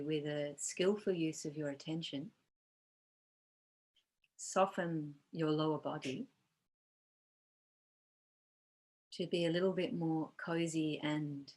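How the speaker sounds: calm, unhurried meditation guide with long pauses; guided meditation. Real, not acted. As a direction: gently lead them into body awareness, leaving space between phrases.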